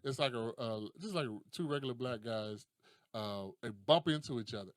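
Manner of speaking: ordinary talk, nothing marked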